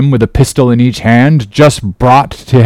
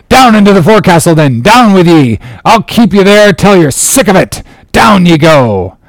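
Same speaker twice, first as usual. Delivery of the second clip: raised, forceful captain's order; commanding bark; 6 semitones higher, 3 dB louder than usual. A narrator's reading. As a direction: drive the men below with orders. Captain orders mutineers below.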